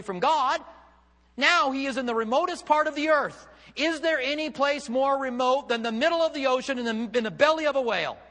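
high, loud, impassioned sermon delivery; full-force preaching. Real, not acted. Preacher explains Jonah's shock to congregation, fervent and loud. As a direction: drive the questions home at full voice.

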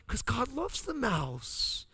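high, slow, sweet voice; gentle wife. Retold or imitated. Husband voicing his sweet wife defending the mouse. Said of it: "she's like".

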